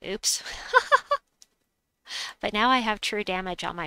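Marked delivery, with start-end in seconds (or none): 0.5-1.2 sudden high-pitched laugh; high giggle. Real, not acted. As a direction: squeal a delighted giggle. Gleeful at combo.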